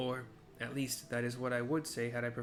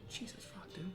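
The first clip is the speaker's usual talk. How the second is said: real breathless whisper, higher; stunned whisper; 8 semitones higher, 7 dB quieter than usual. Real, not acted. Gamer reacting to the cruel father in the cutscene: shocked whisper. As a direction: hiss it under your breath in disbelief.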